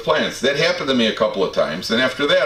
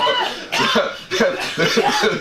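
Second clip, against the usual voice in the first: real laughter; 11 semitones higher than usual. Real, not acted. Laughter continues after the joke.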